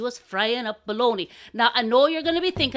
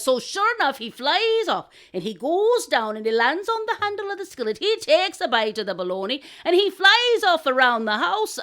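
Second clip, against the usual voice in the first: higher, swooping narration; animated storyteller; 5 semitones higher, 4 dB louder than usual. A narrator's reading. Telling the crow's flight to listeners, animated narration. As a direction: build the flight excitedly line by line.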